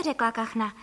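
usual speaking voice

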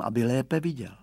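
usual speaking voice

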